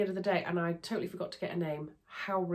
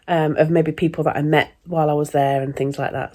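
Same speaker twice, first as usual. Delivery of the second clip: ordinary delivery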